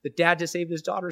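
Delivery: fighting back tears while telling it; starting to cry. Real, not acted. Telling of a grieving family, voice breaking with tears. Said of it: "oh my gosh, I'm gonna cry".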